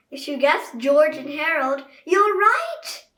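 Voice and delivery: game-show style reveal; excited reveal. Acted. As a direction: announce the winner with glee. Announcing the right answer.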